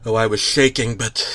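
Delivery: ordinary delivery, nothing marked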